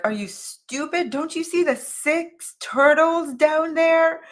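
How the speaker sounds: as if the listener is slow-witted; incredulous exasperation. Retold or imitated. Old man baffled that I can't see the turtles, incredulous. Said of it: "he just looked at me like".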